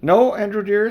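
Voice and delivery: high, loud, hammed-up refusal; a stern aunt saying no; firm aunt. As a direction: shut him down firmly. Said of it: "in her firm, quiet voice".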